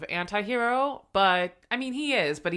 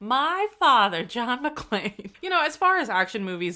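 Meghan McCain impression; 6 semitones higher, 4 dB louder than usual. Host joking as politician's daughter naming her father, mock-proud.

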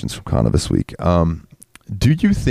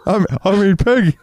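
much higher, strained cartoon Texan dad voice; Hank Hill impression; 13 semitones higher than usual. Riffing on Mike Judge in bed, much higher cartoon dad voice. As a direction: squeeze out a cartoon dad's panicked cry. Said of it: "Mike Judge is railing some chick".

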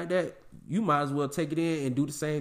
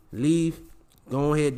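ordinary talk, nothing marked

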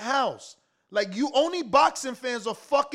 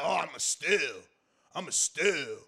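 mock proud bragging; 9 semitones lower, 8 dB quieter than usual. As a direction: lower and softer, a mocking boast. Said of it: "Say it so proud".

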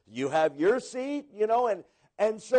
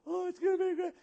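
high, soft, sentimental gushing; mock gushing well-wishers; 11 semitones higher, 7 dB quieter, much faster than usual. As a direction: coo over the couple like teary church ladies. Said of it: "people crying".

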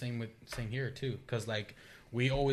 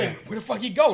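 louder and higher, baffled; where did he go, mock outrage; a retold confused yell; confused outburst; 9 semitones higher, 8 dB louder than usual. Retold confusion, friend vanished.

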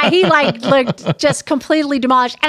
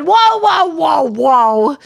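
mock the son backing off; imitating son; much slower than usual. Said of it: "And he's going".

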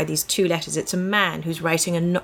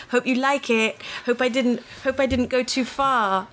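higher-pitched, open-ended, slightly wistful pleading; mock a needy writer hoping to please, pitched up; imitating supplicatory letter; 7 semitones higher than usual. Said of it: "supplicatory and open-ended and slightly wistful".

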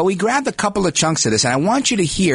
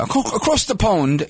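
slow, drawn-out British accent on "across the pond"; playful British accent, said a touch higher; 4 semitones higher than usual. Shown as a demonstration. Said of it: "I'm using my British accent".

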